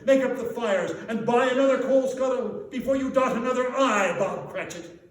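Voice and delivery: loud, high, jubilant; joyful proclamation. Acted. Scrooge joyfully telling Bob of his raise, loud and jubilant. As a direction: announce it bursting with goodwill.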